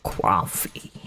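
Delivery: low, muttered Brooklyn "Coffee" said as "cwaffee"; quietly savouring "Coffee" in Brooklyn style; Brooklyn accent. Pretend. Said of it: "my favourite word in a Brooklyn accent".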